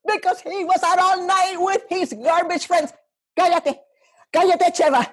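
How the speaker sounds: a mother's scolding, high, far from her own voice. Acted. Mother pressing her daughter to choose her over the father, high and scolding. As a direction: demand the child pick her side, rising to a high scolding pitch. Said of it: "when I do my parents, they get loud".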